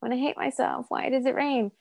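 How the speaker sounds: mocking people who moan about rain; mock whiny complainer. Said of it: "Other people would have been like".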